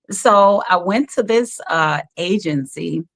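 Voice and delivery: usual speaking voice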